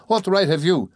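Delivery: high-pitched indignant challenge; offended. A narrator's reading. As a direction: challenge him, stung and affronted.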